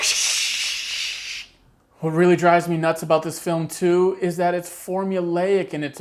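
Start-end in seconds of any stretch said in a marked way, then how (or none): 0.0-1.5 long hissed microphone static; drawn-out rushing shush; imitated wind noise. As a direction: imitate wind drowning out a mic. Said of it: "because it's all like".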